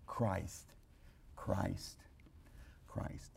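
dying to a hush; fading repetition. Acted. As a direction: let each repetition fade away. Fading repeated name.

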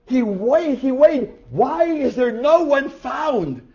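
high, loud, slow anguished cry; weeping lament. Retold or imitated. John weeping that no one is found, high lament. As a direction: wail it out in grief. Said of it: "And I wept greatly".

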